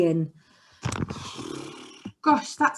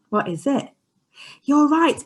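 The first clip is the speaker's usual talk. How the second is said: ordinary talk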